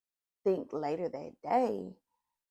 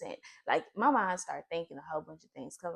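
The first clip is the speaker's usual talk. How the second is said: normal talking voice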